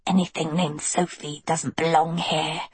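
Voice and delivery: put-on wheezy, scornful albino girl; wheezed. A narrator's reading. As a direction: wheeze it out with contempt. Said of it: "the albino wheezed".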